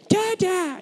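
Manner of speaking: put-on high little-kid Dada; imitating toddler. Toddler greeting dad at the door, squeaky. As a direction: squeak it like a baby. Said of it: "he'll go".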